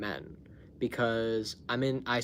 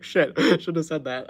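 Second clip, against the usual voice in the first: shouldn't have said that, laughing loudly; 3 semitones higher, 8 dB louder than usual. Retold or imitated. Regretting her reply to Craig, loud laughter. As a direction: dissolve into laughter mid-line.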